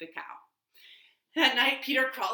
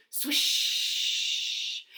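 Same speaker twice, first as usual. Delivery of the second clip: swish like falling leaves; 5 semitones higher than usual. Pretend. Leaves noise effect.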